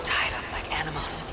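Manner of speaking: whispered, low observation; hushed aside. Acted. Rescuer noticing bound captives, hushed whisper. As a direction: say it under the breath.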